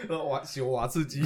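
cracking up in snorts; snorting laughs. Real, not acted. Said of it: "我就有点噗嗤笑出来".

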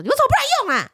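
high, loud whiny scold; mock angry scolding. Wife scolding husband for not helping, high mock scold. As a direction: snap at him in a put-on shrill voice. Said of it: "我又开始会骂他说".

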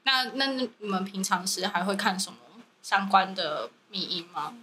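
usual speaking voice

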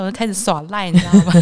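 ordinary delivery

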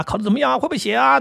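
high, insistent barrage of questions; rapid-fire anxious parent. Retold or imitated. Parent grilling a child outside the exam hall, high rapid questions. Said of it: "这种连珠炮式的那种关心".

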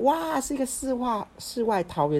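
high, bright burst of wonder at a discovery; excited wow. Real, not acted. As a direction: gush over it like finding paradise.

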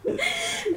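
a high squeal of laughter; high laughing squeal. Real, not acted. Laughing at her own story. (高声尖笑)

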